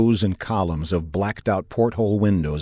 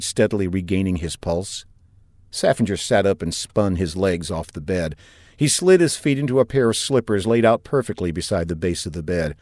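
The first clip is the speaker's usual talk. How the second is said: usual speaking voice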